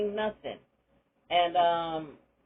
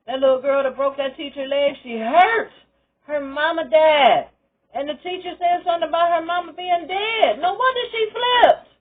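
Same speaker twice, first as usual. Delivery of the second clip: much higher and louder, building to the top; rising outrage; 9 semitones higher, 10 dB louder than usual. Real, not acted. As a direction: climb into indignant protest. Heated defence of hurt student.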